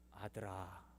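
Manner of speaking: low, soft divine voice; God calling the son in the night. Retold or imitated. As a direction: call him gently from behind. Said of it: "음성이 들린 거예요".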